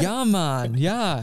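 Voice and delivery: high, loose Jamaican accent imitation. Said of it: "he had, like, a Jamaican accent".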